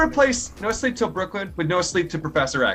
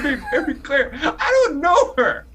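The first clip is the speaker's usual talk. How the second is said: high, slow, loud dramatic diva; exaggerated diva voice; 11 semitones higher, 6 dB louder than usual. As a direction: overplay it like a diva.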